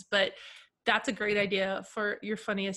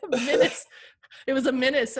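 "Menace" punched louder, mock outrage; mocking emphasis; 3 semitones higher, 7 dB louder than usual. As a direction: land hard on "Menace". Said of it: "it said menace on there".

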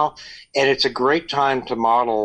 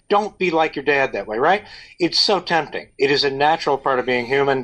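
normal talking voice

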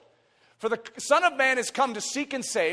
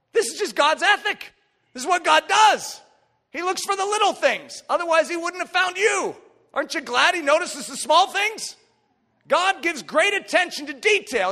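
high, loud, impassioned preacher; full-force preaching; 9 semitones higher, 6 dB louder than usual. Real, not acted. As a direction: ring it out to the whole congregation.

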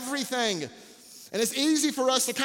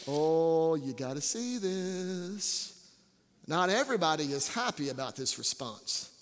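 hushed suspense; 7 semitones lower, 6 dB quieter than usual. Real, not acted. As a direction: draw them in with a hush. Hushed lead into grumbling.

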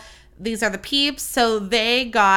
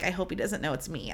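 low-key mutter to herself, hoping not to be noticed; hushed embarrassed thought; 3 semitones lower, 11 dB quieter than usual. Retold or imitated. Leaving the theater, hoping the dad won't recognize her, hushed. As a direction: say it under your breath, a little sheepish.

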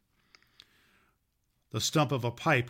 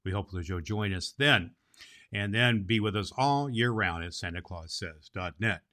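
ordinary delivery, nothing marked

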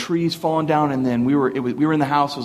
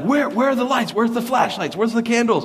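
frantic dad; 9 semitones higher than usual. Retold or imitated. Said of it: "my dad's like".

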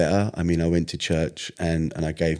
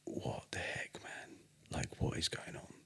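hushed, breathy disbelief, much softer; stunned near-whisper; 20 dB quieter than usual. Retold or imitated. Retelling his stunned reaction to God.

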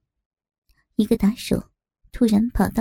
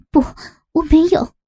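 higher, louder protest; firm, forceful denial; 6 semitones higher, 4 dB louder than usual. Acted. Captive denies setting a trap, firm and forceful. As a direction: deny it hard with a raised voice. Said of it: "文杰坚定并强硬的回答".

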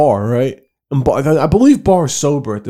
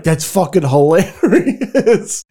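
slow, higher, drawn out into laughter; cracking up; 5 semitones higher, much slower than usual. Retold or imitated. Son to his upset mom, cracking up. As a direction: re-enact helpless laughter through the words.

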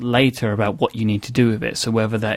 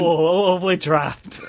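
northern dad voice; 6 semitones higher, slower than usual. Retold or imitated. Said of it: "It's my dad again".

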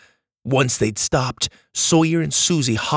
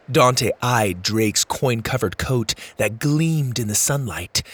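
ordinary delivery

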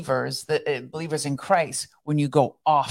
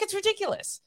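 imitating friend. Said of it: "She's like".

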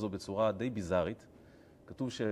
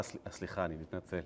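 low, very soft apology; sheepish apology; 4 semitones lower, 9 dB quieter than usual. Acted. Balaam answering the donkey's yelling, sheepish apology. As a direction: mumble sorry, low and small.

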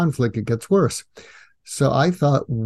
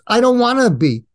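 high, emphatic imitated complaint; mock protest; 9 semitones higher, 4 dB louder than usual. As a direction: imitate someone balking, pitched up. Retold woman's protest in article.

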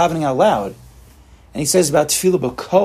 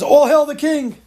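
proclamation; 10 semitones higher, 4 dB louder, slower than usual. Acted. Courtier proclaiming to a king.

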